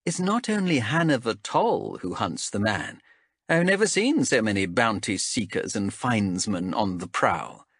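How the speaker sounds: lightly voiced stern elf captain; a hint of a cool interrogator. Zenthi voiced by narrator.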